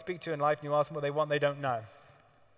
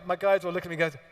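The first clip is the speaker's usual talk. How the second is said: normal talking voice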